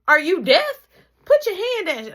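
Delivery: high, loud scolding mother; scolding mom. Retold or imitated. Mom telling her son to put his hand down, scolding. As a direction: snap at your kid to put his hand down.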